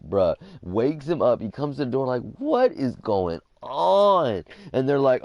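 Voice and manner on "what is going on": drawn-out, raised imitation of an indignant dad; bewildered dad. Woken dad confronting police at the door, mock indignant.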